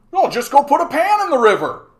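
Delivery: loud, very high yokel caricature; hammed-up mocking falsetto; mocked clueless guy. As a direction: holler it like a clueless fool. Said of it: "is a guy saying".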